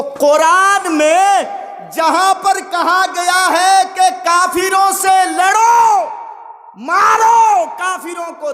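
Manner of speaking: high, slow preacher's proclamation; declaimed command. Retold or imitated. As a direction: call it out high, stretching the key words.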